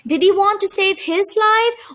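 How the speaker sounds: ordinary delivery, nothing marked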